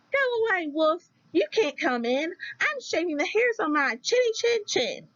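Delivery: high, squeaky little pig refusing the wolf. Acted. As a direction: pitch way up like a tiny stubborn pig. Pig answers wolf through door.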